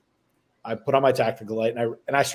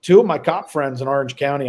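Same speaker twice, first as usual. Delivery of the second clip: ordinary talk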